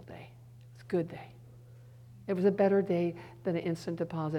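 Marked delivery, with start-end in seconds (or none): 0.0-1.3 hushed whisper of wonder. Real, not acted. Preacher recalls a precious day, whispered. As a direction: breathe it out like a precious secret. Tender memory of hard day.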